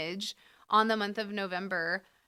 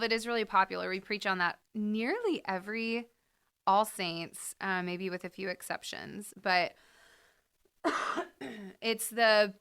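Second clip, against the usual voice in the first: normal talking voice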